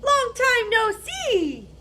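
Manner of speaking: high, slow sing-song hare; high drawn-out hare greeting. Acted. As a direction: stretch it out high like a cheerful hare. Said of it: "says the hare".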